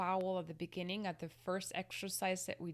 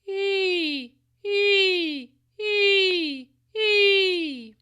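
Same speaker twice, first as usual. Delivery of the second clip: much higher, much louder, crying ee; scolding ee; 13 semitones higher, 18 dB louder, much slower than usual. Shown as a demonstration. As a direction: bite the apple and scold on ee. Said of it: "scolding sound, and like if you're biting an apple".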